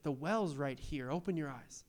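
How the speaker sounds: calm them down gently; gentle. Retold or imitated. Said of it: "And Jesus is like".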